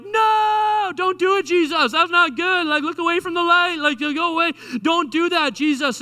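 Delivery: high, loud panicked plea; disciples protesting. Acted. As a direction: cry out begging him not to go. Disciples protesting Jesus's death, acted.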